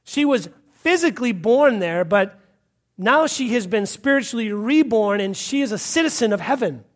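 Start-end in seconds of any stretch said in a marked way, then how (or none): none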